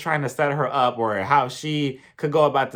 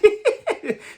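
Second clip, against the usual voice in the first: high laugh; 4 dB louder than usual. Real, not acted. Laughing at own retort.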